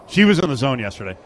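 normal talking voice

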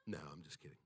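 soft whispered joke; whispered aside. Real, not acted. Preacher taking back a joke about his wife, whispered. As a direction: whisper it like a secret to the crowd.

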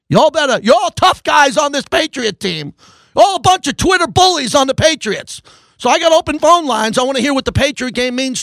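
radio host taunting Patriots fans to call in; fired-up challenge. Real, not acted. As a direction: call out the tough guys, raise it up. Host goads callers.

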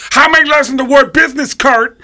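high, loud scolding; loud comic tirade; Tracy Morgan impression. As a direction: berate him in a high shouted mimicry. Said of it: "your impression of him is spot on".

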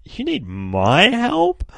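very high, slow, sneering voice; mocking Viltrumite girl. Viltrumite girl mocking the plea, very high and slow. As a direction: stretch it out high, disbelieving. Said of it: "she's just like".

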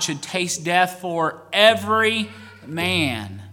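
normal talking voice

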